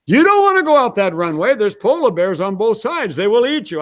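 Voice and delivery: high-pitched, mock-alarmed warning; alarmed radio operator. Radio operator warning about polar bears on the runway, alarmed and high. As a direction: warn urgently, pitch jumping right up. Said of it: "the poor radio operator says".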